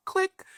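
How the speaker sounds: squeaky mouth click sound; tiny high-pitched click; high click noise. Retold or imitated. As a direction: mimic the phone hanging up. Imitating hanging up phone.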